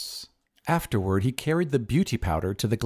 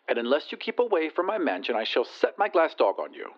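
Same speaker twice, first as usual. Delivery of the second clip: high, haughty lady on the telephone; disdainful lady, pitched well up; haughty rich lady; 5 semitones higher than usual. Acted. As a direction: put on a high lady's voice down the line.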